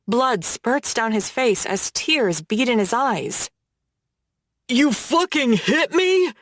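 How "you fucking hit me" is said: high, slow, choked-up cry of shock; choked disbelief. A narrator's reading. Bloodied rich boy choking out his shock at being hit. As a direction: gasp it out through a bloody nose. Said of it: "he chokes".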